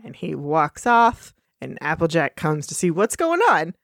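ordinary talk

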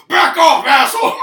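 loud high yell at a mugger; shout. Retold or imitated. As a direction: bark it out loud. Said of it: "then he's like".

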